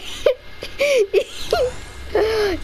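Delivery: laughing till near tears; high crying laugh. Real, not acted. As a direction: break up laughing. Teammate blew himself up.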